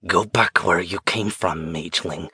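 low rasping whisper of something inside her; possessed whisper. A narrator's reading. Possessed woman warning the mage away, low rasping whisper. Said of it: "she whispered".

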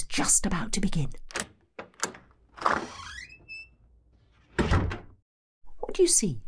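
hushed whisper so as not to disturb the play. Acted. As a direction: whisper it, the actors are on stage. Sneaking into theatre during performance.

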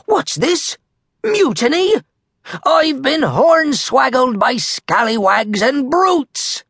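high, indignant squawk; outraged pirate captain. Acted. Captain Red facing mutiny, high outraged cry.

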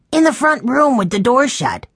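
put-on high-pitched child's answer; excited young boy. A narrator's reading. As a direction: report it like an agitated little boy. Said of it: "Benny answered".

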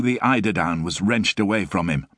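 ordinary delivery

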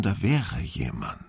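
plain reading voice

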